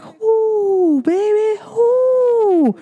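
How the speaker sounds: long, high hooting exclamation; high-pitched acted reaction; high drawn-out whoo. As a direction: stretch it out high, like a hoot. Exclaims her reaction in retelling.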